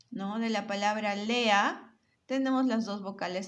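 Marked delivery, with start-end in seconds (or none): none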